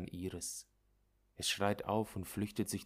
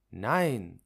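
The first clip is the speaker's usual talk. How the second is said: lautes, verzweifeltes Nein; Aufschrei; 10 dB louder than usual. Acted. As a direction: schrei es erschrocken heraus. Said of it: "schreit Rosa". (Rosa schreit laut Nein)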